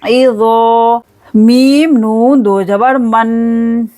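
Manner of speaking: ordinary delivery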